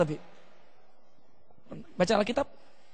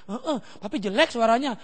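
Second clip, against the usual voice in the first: imitated child; 9 dB quieter than usual. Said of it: "Bukan yang doa mereka".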